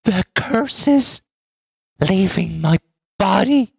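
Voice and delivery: high, slow, eerie unhinged voice; raving madwoman. Acted. GM voicing the mad woman muttering about curses. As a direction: drift through the words like a lost mind.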